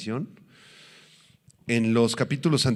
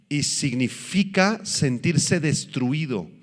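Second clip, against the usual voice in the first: ordinary talk, nothing marked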